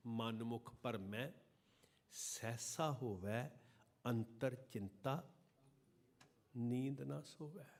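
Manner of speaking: slow, measured chanting of the verse; slow devotional recitation; scripture recitation. Acted. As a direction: recite it slowly and reverently.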